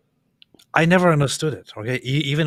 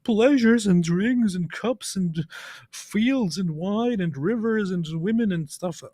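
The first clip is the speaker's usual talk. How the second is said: higher, louder preacher listing rewards, slowing at the end; mock preacher; 8 semitones higher, 5 dB louder than usual. Mocking preachers who promise heavenly rewards. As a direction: ham up an eager preacher's paradise list. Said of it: "went on to describe".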